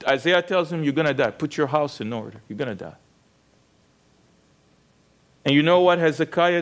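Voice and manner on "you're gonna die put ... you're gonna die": fading grim verdict. Retold or imitated. Prophet's verdict voiced, fading down.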